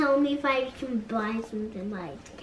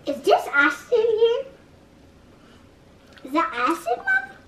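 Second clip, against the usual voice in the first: alarmed; 10 semitones higher, 4 dB louder than usual. Real, not acted. Child alarmed by acid.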